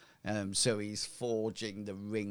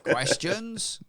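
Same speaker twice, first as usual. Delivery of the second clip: high, louder questioning rise; startled query; 10 semitones higher, 5 dB louder than usual. Acted. Blacksmith taken aback by visitors with questions, startled. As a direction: echo it back in surprise.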